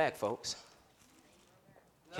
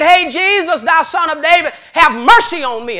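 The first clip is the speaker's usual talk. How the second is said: calling out loud and high; loud cry out; 11 semitones higher, 13 dB louder than usual. Acted. Blind Bartimaeus begging Jesus for mercy, loud.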